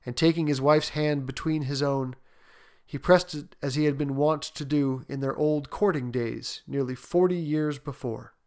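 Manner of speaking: ordinary delivery, nothing marked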